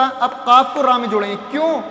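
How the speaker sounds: ordinary talk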